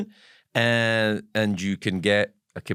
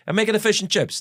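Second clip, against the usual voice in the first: a light Glasgow Italian accent, voiced higher; mock Glasgow Italian chip shop owner, lightly done; 10 semitones higher, faster than usual. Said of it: "Glasgow Italian accents".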